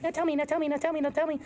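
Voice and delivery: high, insistent mock pleading; pleading girlfriend. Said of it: "She's like".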